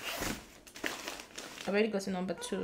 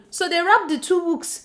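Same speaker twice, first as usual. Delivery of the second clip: amused disbelief; 7 semitones higher, 5 dB louder than usual. Real, not acted. Laughing disbelief at wrapped books.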